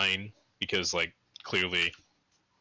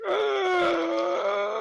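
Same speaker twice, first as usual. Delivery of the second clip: high, loud zombie wail; zombie groan; 4 dB louder than usual. Retold or imitated. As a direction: let out a zombie cry. Zombie imitation.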